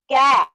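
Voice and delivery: "कै" as one loud blended syllable; joined syllable. Shown as a demonstration. Teacher modelling the right reading, "कै" joined and loud. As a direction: punch out "कै" in one go. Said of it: "आपने बोलना है".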